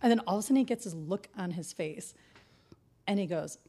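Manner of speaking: normal talking voice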